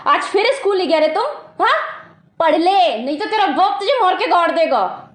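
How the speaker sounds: very high-pitched nagging scold; scolding mother. Acted. Mother catches child skipping school again, high-pitched scolding.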